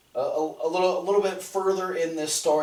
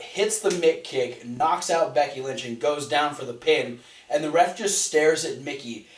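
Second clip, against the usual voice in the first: ordinary talk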